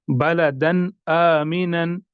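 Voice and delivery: "बलदन आमिनन" slow, final न of बलदन sounded plainly; careful recitation; clear tanween. Shown as a demonstration. Teacher recites for students. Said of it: "जाहिर करके पढ़ेंगे".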